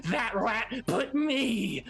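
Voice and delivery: high, brash goblin voice; cocky goblin Ripper. Acted. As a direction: boast with a laugh. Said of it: "he's like".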